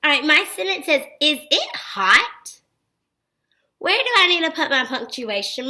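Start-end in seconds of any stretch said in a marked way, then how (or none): 1.2-2.6 "Is it hot?" very slow, rising on "hot"; question voice. Shown as a demonstration. Teacher reading "Is it hot?" for children to spot a question, questioning voice. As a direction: ask "Is it hot?" drawn out, with "hot" lifted. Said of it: "A question mark".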